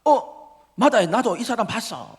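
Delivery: loud, high burst of recognition; excited accuser. Acted. Another man recognising Peter by the fire, excited accusation. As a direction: pipe up high and loud as an eyewitness. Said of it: "이제 다른 남자가".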